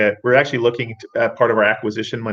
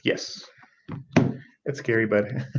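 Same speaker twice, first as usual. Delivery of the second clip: ordinary talk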